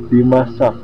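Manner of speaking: ordinary delivery, nothing marked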